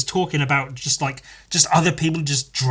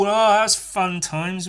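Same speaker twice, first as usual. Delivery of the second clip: ordinary talk, nothing marked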